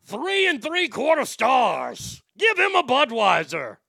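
loud, high, booming announcer; Ed McMahon. Shown as a demonstration. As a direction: bellow it like a talent-show host. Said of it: "Ed McMahon was the truth".

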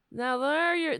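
ordinary delivery

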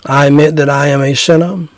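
earnest, measured prayer voice. Acted. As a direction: pray it aloud for the listener to repeat. Leads a sinner's prayer.

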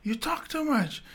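put-on high-pitched scolding old lady; lady scolding driver. Old lady pointing at driver: high scolding voice. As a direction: jab each word at the chatty driver. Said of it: "I see her hand pointing to him".

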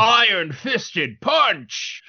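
slow, high-pitched game attack cry; drawn-out mock shout, no real volume; weapon call-out. Shown as a demonstration. Player calling his weapon like a video game, mock shout. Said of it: "I shout it out".

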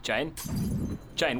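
ordinary delivery

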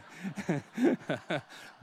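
a burst of real laughter. Real, not acted. Preacher laughing at his Bible-school joke, real laughter. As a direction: laugh at your own joke.

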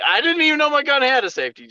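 high, fast, incredulous squeal; rushed high-pitched exasperation; incredulous protest. Real, not acted. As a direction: protest it in comic disbelief. Protesting in disbelief at the mishap.

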